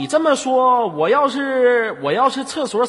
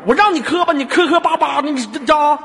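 raised-pitch exasperated scolding; impatient mock anger; 5 semitones higher, 4 dB louder than usual. Host scolding caller for stammering, impatient mock anger. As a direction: pile on the hammed-up impatience. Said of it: "你不要那么着急".

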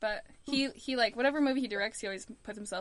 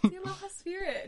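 lightly voiced, higher pitched gushing tourist; mock tourist gush; 9 semitones higher, 4 dB louder than usual. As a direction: say it like a starry-eyed visitor, half mocking. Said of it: "They're like".

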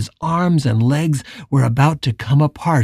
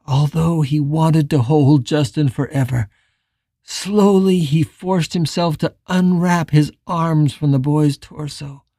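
ordinary delivery, nothing marked

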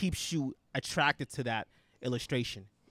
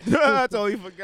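laughing; 7 semitones higher, 7 dB louder than usual. Real, not acted. Laughing after smoking weed.

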